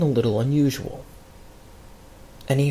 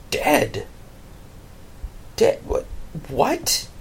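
stunned whisper; 3 semitones higher, 5 dB quieter, much slower than usual. Acted. Aaron stunned, just revived.

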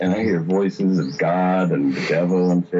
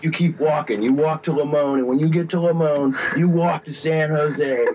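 higher-pitched imitated devil giving marching orders; insistent mock devil orders, raised in pitch; big devil voice; 6 semitones higher than usual. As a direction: lightly play a commanding devil. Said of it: "hearing this big devil voice".

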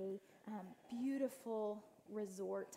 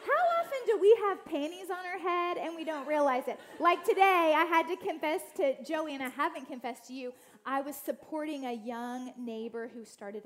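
louder, higher, fired-up sermon voice; preaching; 5 semitones higher, 10 dB louder than usual. Acted. Urging listeners about getting it wrong, preaching loud. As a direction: drive the point home with rising fervor.

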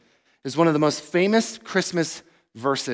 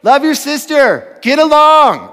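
put-on loud, high parent yell at fighting kids; fed-up father yelling at his fighting kids to stop; 9 semitones higher, 10 dB louder than usual. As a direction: shout it like a fed-up dad. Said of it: "I'm often just yelling at them".

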